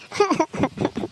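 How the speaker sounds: short broken acted sobs; weepy sobs in a drama; sobbing. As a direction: sob in short bursts, falling away. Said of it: "ಎಷ್ಟ್ ಅಳ್ತೀಯ".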